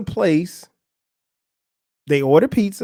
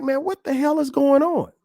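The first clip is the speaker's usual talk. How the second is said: high-pitched, incredulous retold question; baffled reaction; 9 semitones higher than usual. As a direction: act out total confusion, voice raised in pitch. Acts out own confused reaction.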